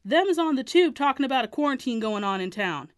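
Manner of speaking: put-on father yelling at kids; angry father.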